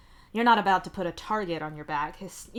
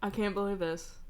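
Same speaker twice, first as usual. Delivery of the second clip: ordinary delivery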